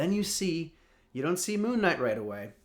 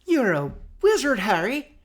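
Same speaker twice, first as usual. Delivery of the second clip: much higher and louder, a big proclamation; hammed-up grand announcement; booming movie quote; 10 semitones higher, 8 dB louder than usual. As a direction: bellow it like the famous film line. Joke swap for famous movie line.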